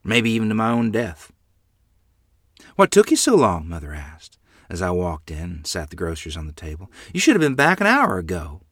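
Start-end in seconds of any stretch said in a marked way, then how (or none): 2.8-3.7 high, loud mother's question; mother's scolding demand. Acted. Mother to a child late with groceries, scolding. 7.1-8.6 raised, louder reproach; mother's scolding. Acted. Mother to child back late from the store, scolding.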